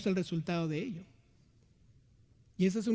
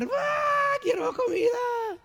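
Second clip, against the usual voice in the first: put-on screaming baby; 14 semitones higher, much slower than usual. Preacher imitates his seven-month-old screaming for food, high shrill wail. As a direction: shriek like a hungry infant. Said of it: "y empieza a gritar".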